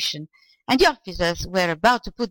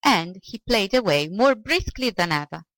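ordinary delivery, nothing marked

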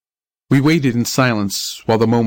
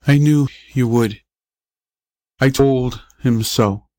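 weak, halting. A narrator's reading. Injured boy speaking from his bed.

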